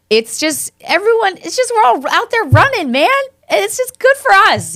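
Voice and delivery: high, bright burst of enthusiasm about running together; gushing excitement. Real, not acted. Telling a friend about the 5K, raving with high excitement. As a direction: let it lift way up as you rave.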